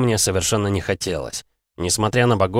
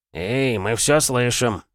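higher, drawn-out objection; slow, indignant protest from an offended god; 5 semitones higher, much slower than usual. A narrator's reading. Paunchy god overhears the hero's insult, indignant and drawn out. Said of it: "возмутился тот самый Пузан".

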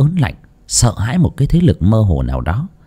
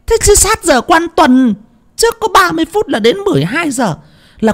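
much higher, rising into a shouted rebuke; scolding outcry. A narrator's reading. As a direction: berate her in alarm. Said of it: "hú lên một cái".

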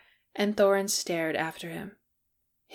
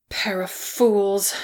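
slow, whispery venom; vicious; 4 dB louder, much slower than usual. A narrator's reading. Dwarf cursing the two who waited too long, vicious. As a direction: spit it out slowly with contempt. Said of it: "said Beaufort with unexpected viciousness".

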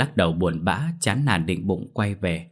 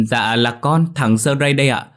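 raised, higher deferential announcement; eager-to-appease servant, pitched up and loud; placating; 5 semitones higher, 5 dB louder than usual. A narrator's reading. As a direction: call up politely, holding back annoyance. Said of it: "cố dịu giọng mà lên tiếng".

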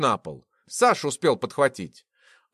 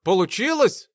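high, eager question; impatiently curious; 5 semitones higher, 3 dB louder than usual. A narrator's reading. Sasha jumping up to see the result, eager. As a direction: ask unable to bear the suspense. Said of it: "вскочил Саша, не вытерпев интриги".

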